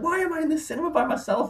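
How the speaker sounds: high, fast, incredulous squeak; lone cinemagoer's high squeaky bafflement; baffled disbelief. Retold or imitated. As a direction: blurt it out, bewildered and tickled. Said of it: "and I was like".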